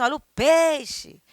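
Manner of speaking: high, drawn-out market cry; street fish seller. Retold or imitated. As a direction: hawk the fish, stretching PEIXE. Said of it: "ou eles falam assim".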